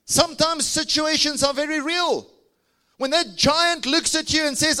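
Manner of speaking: high, loud preaching peak; fiery preaching. Acted. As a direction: preach it at full force.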